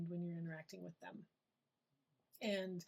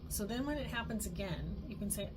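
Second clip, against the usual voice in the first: ordinary talk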